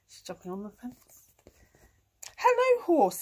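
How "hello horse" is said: high, loud, bright-pitched cat hello; chirpy cat greeting. A narrator's reading. Cat greeting horse in the field, high cat voice. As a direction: call out as the storybook cat. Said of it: "said Kat".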